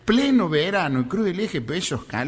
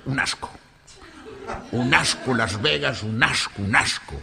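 put-on low grumble in a Spanish accent; grumpy Galician, deep and deliberate; disgusted Galician; 5 semitones lower, 3 dB quieter than usual. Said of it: "Volvió muy enojado el gallego". (gallego enojado, grave y seco)

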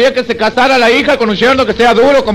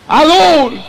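calling out; 5 semitones higher than usual. Acted. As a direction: shout the name into the dark. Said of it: "Grita Adul".

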